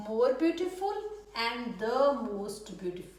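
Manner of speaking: slow, word-by-word dictation pace; slow model sentence. Shown as a demonstration. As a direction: spell it out slowly for the class. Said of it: "तो इसमें भी क्या आएगा?". (धीमे, साफ़ उदाहरण वाक्य)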